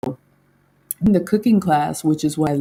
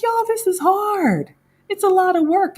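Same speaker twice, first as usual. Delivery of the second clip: mock whine. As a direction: whine it like you're in over your head. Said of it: "I'm like".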